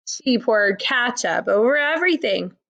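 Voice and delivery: usual speaking voice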